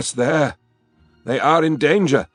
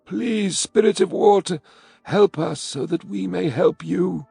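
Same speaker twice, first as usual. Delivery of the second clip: put-on high, pleading old voice; a broken elder's begging please; 13 semitones higher than usual. A narrator's reading. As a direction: plead as if all is lost. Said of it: "he begged".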